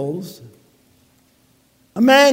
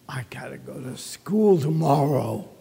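whisper; 7 semitones lower, 6 dB quieter than usual. Retold or imitated. Child dreading Sunday night.

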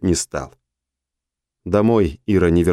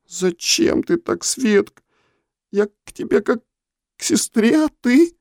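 much higher, slow hurt plea; tearful. A narrator's reading. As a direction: plead through welling tears. Tasha hurt, tears welling. (со слезами в голосе)

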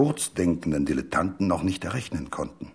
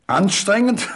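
high, loud scornful echo of the word; mocking laugh; 14 semitones higher, 6 dB louder than usual. A narrator's reading. Doktor B. mocks the narrator's warning with laughter. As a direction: fling the word back with a malicious laugh. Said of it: "lachte er laut und boshaft".

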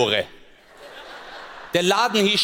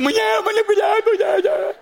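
mock wailing; 4 dB louder than usual. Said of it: "Was ist passiert?".

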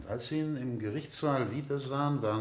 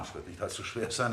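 ordinary talk

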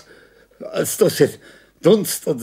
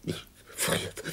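whisper; 14 dB quieter than usual. Acted. Faint echo of his complaint.

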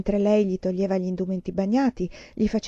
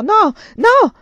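high, loud anguished scream; a sudden terrified shout of no; 13 semitones higher, 13 dB louder than usual. A narrator's reading. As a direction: scream it from a nightmare. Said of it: "urlò".

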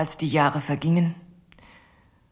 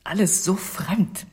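ordinary talk

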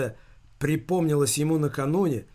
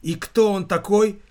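higher, louder edgy question; nervous question; 5 semitones higher, 5 dB louder than usual. A narrator's reading. Son asks mother about the mystery caller, nervously. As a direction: ask it on edge. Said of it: "нервно спросил Виктор".

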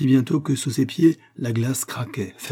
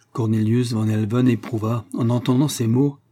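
usual speaking voice

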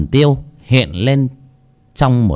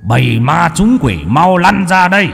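thundering shout; 9 semitones higher than usual. Acted. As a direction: roar at the hiding demons. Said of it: "mà quát".

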